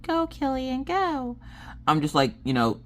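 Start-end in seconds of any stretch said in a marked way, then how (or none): 0.0-1.4 high, drawn-out call to a dog; high-pitched non-firm command. Shown as a demonstration. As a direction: sing it out high and weak, not firm. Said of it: "I usually am not, I'm not like".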